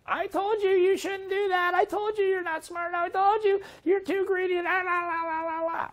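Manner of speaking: high, shrill-pitched nagging mother, mocking. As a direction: parody a scolding mother, sing-song la la at the end. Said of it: "This one actually is my mother without the hair".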